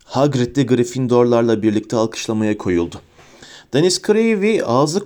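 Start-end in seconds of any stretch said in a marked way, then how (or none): none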